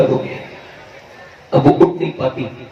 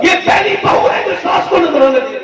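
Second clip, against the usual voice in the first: high, fervent sermon shout; impassioned preaching; 9 semitones higher than usual. Acted. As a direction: preach it out with full force. Preaching at full force. (جوشیلا وعظ، بلند آواز میں)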